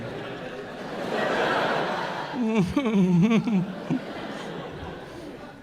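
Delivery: high little idle hums; playful high-pitched humming, imitating a toddler. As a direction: hum like a small child waiting. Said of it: "like a fucking toddler waiting".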